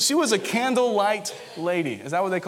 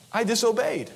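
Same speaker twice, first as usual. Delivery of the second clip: ordinary talk, nothing marked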